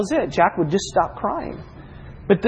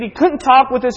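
ordinary talk, nothing marked

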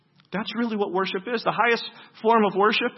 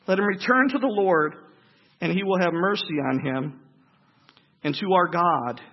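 scripture reading; 5 dB louder than usual. Acted. Preacher reads scripture aloud.

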